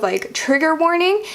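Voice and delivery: ordinary talk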